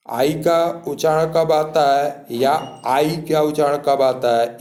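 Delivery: ordinary delivery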